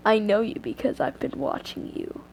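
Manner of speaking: low, hushed creepy voice; quiet, menacing male figure; mysterious stalker. Acted. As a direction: murmur it softly and deep, like a lurking stranger.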